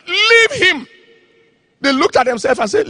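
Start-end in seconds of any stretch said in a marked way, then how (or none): 0.1-0.9 commanding shout. Retold or imitated. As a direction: cast it out with force. Preacher commands demon.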